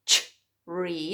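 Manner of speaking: tree split as ch, then ree; mistaken first sound. Shown as a demonstration. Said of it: "but it's not tr".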